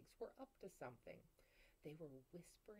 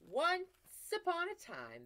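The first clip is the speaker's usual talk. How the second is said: higher, louder fairy tale opening; story opening; 11 semitones higher, 10 dB louder than usual. Acted. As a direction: announce the start grandly.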